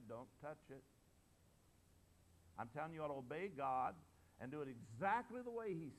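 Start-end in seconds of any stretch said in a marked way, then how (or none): 0.1-0.8 simple quiet command. Retold or imitated. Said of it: "He just said".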